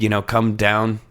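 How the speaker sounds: ordinary talk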